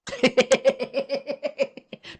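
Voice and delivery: big high cackle of derision; a long jeering laugh; loud mocking laugh. Real, not acted. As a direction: burst out laughing at him. Mocking a man's dying words.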